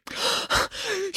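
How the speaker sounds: high, breathless gasp; acted out-of-breath gasp; panting gasp. A narrator's reading. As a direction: gasp for air after running. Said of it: "she was panting".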